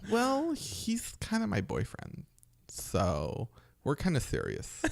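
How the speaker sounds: high, soft, slow camp voice. Retold or imitated. Buddy confessing the boyfriend in a slow camp voice. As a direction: coyly reveal the boyfriend.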